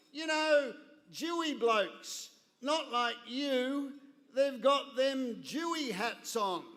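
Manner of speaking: broad Australian bloke spelling it out; broad Australian accent. Retold or imitated. Broad Australian, an agent explaining the visitors to the father. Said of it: "who had the most Australian accent".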